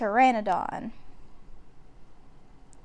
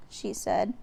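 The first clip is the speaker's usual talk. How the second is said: usual speaking voice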